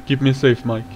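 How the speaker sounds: low, hushed plea; quiet, low-voiced appeal; wary plea. Real, not acted. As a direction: ask softly for protection, low in pitch. Wary plea in horror game.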